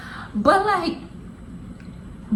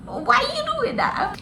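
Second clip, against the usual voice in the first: put-on high-pitched tearful child's whine; squeaky mock-crying complaint. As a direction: imitate a seven-year-old's crying protest. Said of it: "I started crying".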